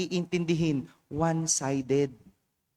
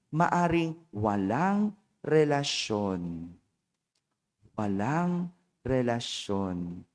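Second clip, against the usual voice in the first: slow emphasis; 7 semitones lower, 3 dB quieter, much slower than usual. Real, not acted. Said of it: "slowed emphatic echo".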